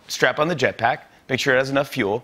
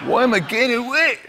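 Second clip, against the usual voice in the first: mock whine; 10 semitones higher than usual. Whiny joke complaint.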